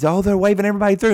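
high, rushed excited exclamation. Retold or imitated. Travelers watching cars get waved through the border, excited. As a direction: blurt it out fast and high in mock excitement. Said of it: "they get so excited".

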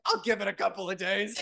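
a hint of lofty aloofness; lightly voiced airy celebrity; smug diva. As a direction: brush it off like a famous star. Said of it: "and I'm like".

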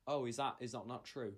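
ordinary talk, nothing marked

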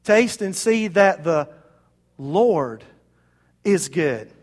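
"Lord" set apart by pauses, low; slow down and isolate "Lord"; measured, with a low, weighty "Lord"; stress on LORD. Shown as a demonstration. Corrected verse after long pause.

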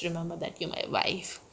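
fast, breathy secret whisper; quick whispered aside. Real, not acted. Whispered aside repeating the line.

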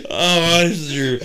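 high, loud wheezing laughter; laughing hysterically. Real, not acted. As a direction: crack up, barely able to speak. Laughing fit.

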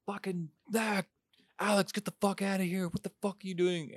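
half-volume high mock shriek; mock screaming. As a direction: imitate her scream in a high, held-back voice. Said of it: "she starts screaming".

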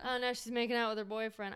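ordinary delivery

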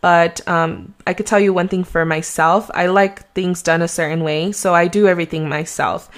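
ordinary talk

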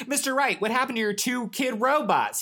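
high-pitched, urgent demand. A narrator's reading. Studio engineer running in to Mister Wright, demanding. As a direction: press for an answer, voice way up. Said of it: "he demanded".

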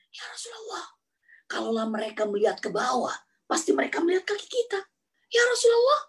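high, worried companion's plea; anxious Abu Bakar. Acted. Abu Bakar warning the Prophet about the enemies, high worried voice. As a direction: whisper the fear of being found, pitched high.

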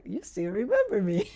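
delighted self-quote breaking into laughter; pleased laughing. Retold or imitated. Realtor touched that old clients still call her, laughing. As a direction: say it through a rising laugh.

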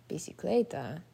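ordinary delivery

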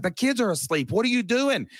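high-pitched, alarmed imitation of his wife; wife freaking out. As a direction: play the startled wife, voice jumping up high. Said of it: "She's like".

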